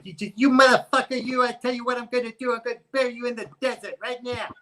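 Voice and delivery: high, loud, fast furious gangster rant; Joe Pesci impression. Podcast guest shows his Joe Pesci impression to the host. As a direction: rattle off the threat like an enraged Joe Pesci. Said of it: "Like Joe Pesci".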